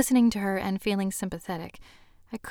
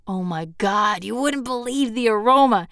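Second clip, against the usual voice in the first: louder, higher, relishing gush; enthusiastic raving; 3 semitones higher, 7 dB louder than usual. Acted. Host selling guests on his annual clam bake, enthusiastic. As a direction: gush about it with wholehearted delight.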